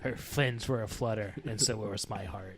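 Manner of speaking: low, soft noir voiceover; noir detective. Acted. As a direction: sigh it like a lovesick gumshoe. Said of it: "The de noir detective?".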